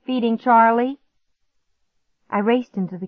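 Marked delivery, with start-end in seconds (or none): 0.0-1.0 high, louder call; raised voice answering from afar; calling back. A narrator's reading. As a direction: call it through the house. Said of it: "he called".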